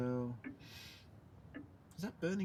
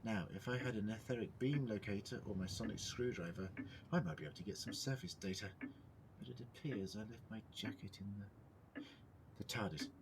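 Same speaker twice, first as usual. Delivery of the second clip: ordinary talk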